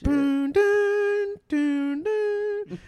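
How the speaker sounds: high hummed booming notes; underwater score sound. Pretend. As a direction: hum a movie score underwater. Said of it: "what would like a fish be sounding like".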